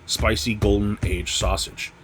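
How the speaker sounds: usual speaking voice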